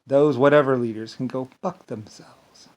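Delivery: ordinary talk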